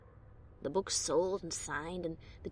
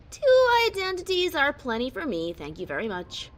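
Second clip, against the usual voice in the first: light, dismissive brush-off, higher and louder; breezy and dismissive; 6 semitones higher, 6 dB louder than usual. Acted. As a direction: toss it off like it's nothing. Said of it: "sound breezy and dismissive".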